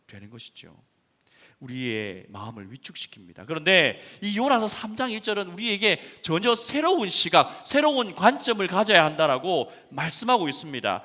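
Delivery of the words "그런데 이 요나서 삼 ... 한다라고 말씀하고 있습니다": loud fervent preaching; preaching at full force. Acted. Pastor urging a new view, fervent loud preaching. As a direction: urge a new view on them.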